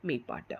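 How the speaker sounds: മെയ്പ്പാട്ട് said low, with a falling tune; term pronounced. Shown as a demonstration. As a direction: give the Tamil term മെയ്പ്പാട്ട് deliberately to the students. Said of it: "which is called".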